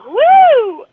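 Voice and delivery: loud high whoop of triumph; excited woo. Retold or imitated. Podcaster mocking her email win, loud excited whoop. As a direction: cheer yourself on, loud and high. Celebrating finishing email lines.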